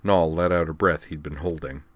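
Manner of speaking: plain reading voice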